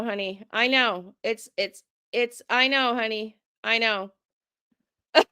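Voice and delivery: ordinary talk, nothing marked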